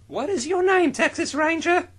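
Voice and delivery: very high squeaky voice; high character voice. Acted. Voicing a character.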